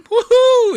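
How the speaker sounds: loud, high-pitched "woohoo" whoop. Acted. Streamer imagining chaos for these guys, loud high whoop. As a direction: burst into a whooping cry far above your voice. Mimes a wild imagined outburst.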